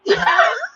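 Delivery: high-pitched mocking cackle; mock devil laugh. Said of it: "the devil's literally laughing at these people".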